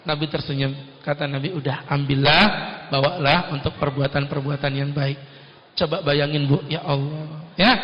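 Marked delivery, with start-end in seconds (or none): 1.5-5.2 low, soft and kind; gentle Prophet. Acted. The Prophet hands the turban to the rude Badui gently. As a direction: give it away kindly.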